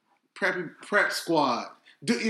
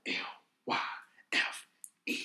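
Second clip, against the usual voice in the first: whisper; 11 dB quieter than usual. Real, not acted. Spelling out name, whispered.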